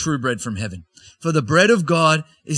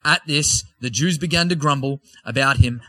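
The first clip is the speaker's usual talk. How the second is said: usual speaking voice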